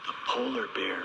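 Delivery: hushed whisper; secretive whisper to the child. Pretend. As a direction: whisper it so the bear won't hear. Whispered after shh.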